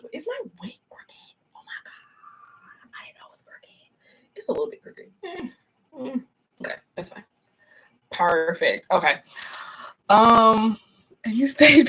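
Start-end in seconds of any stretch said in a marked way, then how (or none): 0.0-7.2 hushed whisper to herself; breathless unvoiced aside. Real, not acted. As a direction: whisper in private dismay over the wig. Notices wig crooked on camera.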